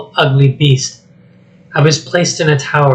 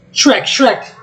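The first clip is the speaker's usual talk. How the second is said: high frantic calls for help; Donkey calling Shrek in panic; panicked calling; 9 semitones higher than usual. Acted. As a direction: shriek for your friend.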